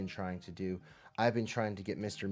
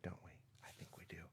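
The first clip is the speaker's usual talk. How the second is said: soft unvoiced whisper; 5 semitones lower, 21 dB quieter, much faster than usual. Real, not acted. Teacher whispering reassurance to young students. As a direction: hush it like a secret. Gentle question to kids.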